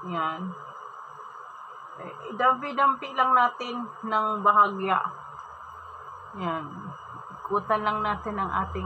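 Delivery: talking through fingers; hand near mouth. Real, not acted. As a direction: fingers brushing lips. Seen 0: Fingers dab mask paste around lips, partly covering the mouth.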